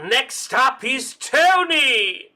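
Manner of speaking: high, loud, drawn-out introduction; announcer call. Pretend. [name] calls Tony up, loud announcer call. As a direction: call the next name out to the hall.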